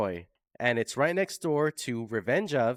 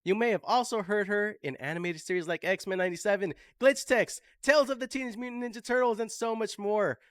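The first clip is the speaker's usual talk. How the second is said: higher, upbeat announcer voice listing credits; announcer introduction; 9 semitones higher than usual. Acted. Host announces guest to the audience, announcer hype.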